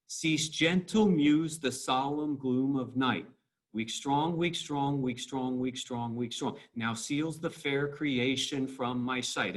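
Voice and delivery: exaggerated iambic beat, weak-STRONG through "Cease, gentle muse"; bouncing metrical stress. Shown as a demonstration. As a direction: bounce slowly on every second syllable, as in "the SOLemn GLOOM of NIGHT". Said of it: "I'm stressing this".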